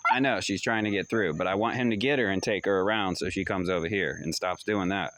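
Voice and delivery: ordinary delivery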